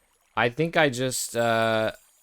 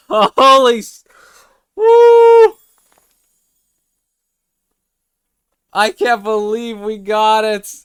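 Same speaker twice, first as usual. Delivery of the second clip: high, loud, laughing disbelief; giddy relief; 10 semitones higher, 11 dB louder, slower than usual. Real, not acted. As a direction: scream with overjoyed relief. Caught shiny after thirty hours.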